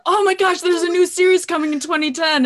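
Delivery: high, loud imitation of giddy excitement; really excited. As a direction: blurt it out like a thrilled fan, hammed up. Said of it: "gets really excited and is like".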